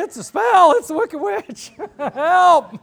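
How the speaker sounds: high, loud frantic cries; flailing and shouting for help; panicked yelling. Retold or imitated. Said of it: "flailing his arms saying".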